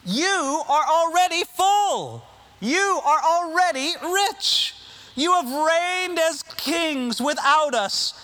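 mock-admiring, slow and high; sarcastic. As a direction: drip sarcasm on every phrase. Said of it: "here's where he gets really, uh, sarcastic".

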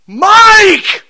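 full-voiced high scream of a name. Stranded kid yelling to his brother down a payphone, screaming. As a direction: scream it down the phone, drawn out. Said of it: "I'm screaming into this phone".

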